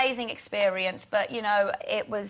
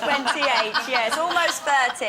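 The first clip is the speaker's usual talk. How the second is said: laughing; 4 semitones higher, 6 dB louder, slower than usual. Real, not acted. Teased about turning twenty-eight.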